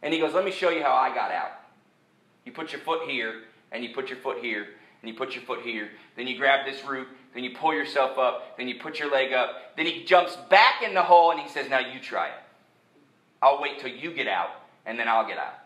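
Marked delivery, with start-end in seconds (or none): 0.3-9.8 low, soft step-by-step coaching; quietly walk someone through it, step by step; calm acted rescuer, voice lowered; patient demonstration. Acts calm rescuer showing the way. 11.7-15.5 low, soft acted rescuer; calm coaching, voice lowered; patient encouragement. Rescuer gently hands over the task.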